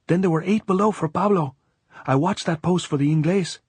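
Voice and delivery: very high, eager lookout voice. A narrator's reading. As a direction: point out each one in a high, urgent voice. Continues the report.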